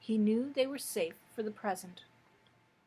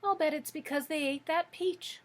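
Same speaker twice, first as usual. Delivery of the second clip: higher-pitched little kitten; kitten's cry; 6 semitones higher than usual. A narrator's reading. Kitten guessing why the piglets vanished, mild high cry. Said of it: "cried the kitten".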